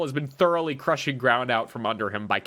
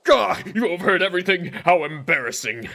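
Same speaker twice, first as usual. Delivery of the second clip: loud, embarrassed outburst; flustered shout; 5 semitones higher, 5 dB louder than usual. Acted. Character caught out, flustered.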